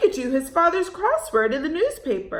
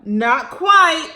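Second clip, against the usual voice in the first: slow, loud storybook reading; reading to children; 5 semitones higher, 5 dB louder than usual. A narrator's reading. Teacher reading a picture book aloud. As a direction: draw the children in slowly.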